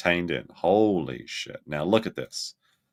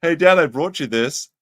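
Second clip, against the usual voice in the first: mock daughter voice; 6 semitones higher, 6 dB louder than usual. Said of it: "daughter brought him".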